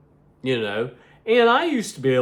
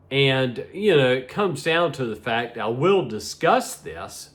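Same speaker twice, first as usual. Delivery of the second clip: ordinary talk, nothing marked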